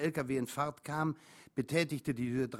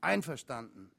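ordinary delivery, nothing marked

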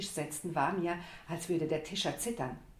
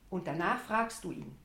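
ordinary delivery, nothing marked

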